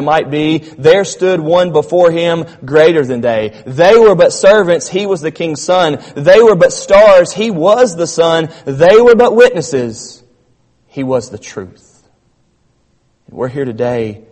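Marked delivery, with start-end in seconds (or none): 6.2-10.2 louder and slower on each contrast; building emphasis. Real, not acted. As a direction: punch every line harder as it builds. Rhetorical contrast, rising force.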